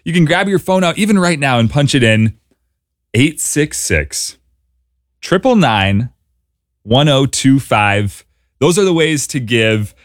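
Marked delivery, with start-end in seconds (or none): 3.1-8.2 slow dictation. Shown as a demonstration. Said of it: "I'll give you a chance".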